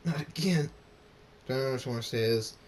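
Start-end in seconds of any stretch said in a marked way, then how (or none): 0.0-0.7 high, raised cry of protest; panicked protest; screamed dismay. A narrator's reading. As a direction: cry out in alarm. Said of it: "Draconix screams".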